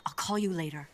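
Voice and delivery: usual speaking voice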